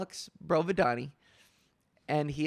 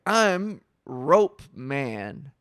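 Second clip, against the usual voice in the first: very slow, lower, grandly announced name; goofy self-introduction; 4 semitones lower, 3 dB louder, much slower than usual. Retold or imitated. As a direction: imitate a dim hero unveiling himself. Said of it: "And he's like".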